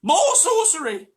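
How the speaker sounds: high, loud and slow mob yell; frightened villagers yelling; villagers shouting. A narrator's reading. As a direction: shriek it at him in terror. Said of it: "shouted the villagers".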